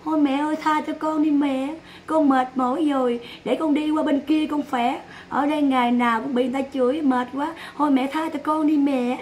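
put-on soft, pleading child's voice, lightly done; imitating the tired child. Child answering his mother on stream, mock weary pleading. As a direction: beg your mom to let you go, wearily. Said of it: "Cái em quay qua nói sao?".

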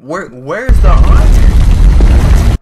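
high, slow, drawn-out yell; frantic yell. Real, not acted. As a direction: wail it out in panic. Frantic search for iron.

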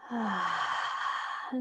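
quiet releasing sigh on the out-breath; soft exhale sigh. Shown as a demonstration. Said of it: "Just using the exhale to release".

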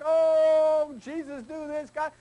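loud high Oh then begging; mock wailing prayer. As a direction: ham up a desperate plea to Jesus. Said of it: "There's Mark".

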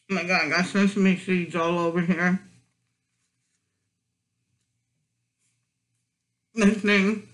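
chewing while talking; muffled through food; mouth full of burger. Real, not acted. Seen 3: Burger held at mouth, cheeks full and chewing through labelled words.

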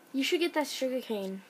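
usual speaking voice

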